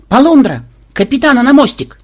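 high, very loud alarm cry; yelling the alarm. A narrator's reading. Melissa spots danger on radar and yells for the captain. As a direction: scream out an all-hands alert. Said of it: "она завопила".

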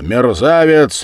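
high, loud, drawn-out bark; raging shout; furious roar. A narrator's reading. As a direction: roar the insult in a rage. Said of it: "рявкнул я, беснуясь".

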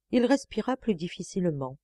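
ordinary delivery

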